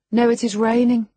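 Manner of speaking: put-on high, bright girlish voice; young girl voice. A narrator's reading. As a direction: voice Cathy up high.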